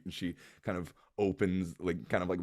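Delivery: ordinary talk, nothing marked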